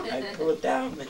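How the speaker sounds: laughing retelling of a panic; mock alarm, breaking into laughter. Retelling Johnny grabbing at the fake snake, laughing.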